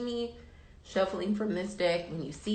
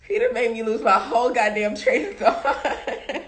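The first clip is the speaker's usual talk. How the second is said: louder, laughing through it; 4 semitones higher, 6 dB louder than usual. Real, not acted. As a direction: laugh while complaining about the dog. Laughing about dog.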